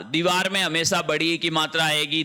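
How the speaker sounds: ordinary delivery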